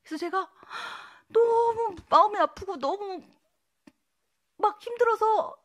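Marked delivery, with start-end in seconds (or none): 1.3-5.5 higher, louder and slow with grief; remembering crying over a lost friend; tearful. Retold or imitated. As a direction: let the grief break through. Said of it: "막 울고 있는데".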